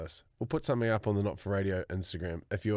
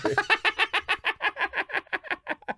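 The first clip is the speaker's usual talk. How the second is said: high laugh. Real, not acted. Laughing at t-shirt.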